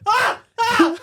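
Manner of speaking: high, loud alarmed yelling back; startled scream. Retold or imitated. Startled kid screams back at his brother, high and loud. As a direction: shriek back in shock, half laughing. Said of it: "And I was like".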